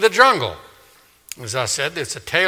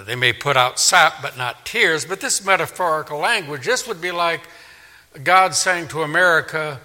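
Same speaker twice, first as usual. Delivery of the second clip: ordinary talk, nothing marked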